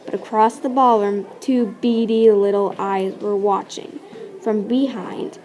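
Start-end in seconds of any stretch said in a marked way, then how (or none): none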